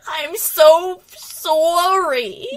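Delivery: slow, loud hammed-up Boston "I'm so sorry"; mock Boston apology. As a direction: stretch the "so" in a thick Boston accent. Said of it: "Hey, what you talking".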